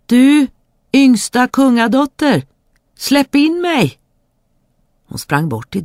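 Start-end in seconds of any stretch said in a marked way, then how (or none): 0.1-4.0 high, loud call at the door; frog calling. Acted. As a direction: call through the closed door. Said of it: "som ropade".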